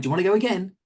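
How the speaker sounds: high, bright mock coach asking for another go; chirpy push to run it again; pushy trainer. Trainer to agent after berating them, mock chirpy voice.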